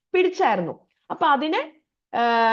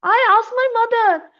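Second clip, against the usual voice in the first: put-on girl's voice; 10 semitones higher, 5 dB louder, much slower than usual. A narrator's reading. Girl retelling to river.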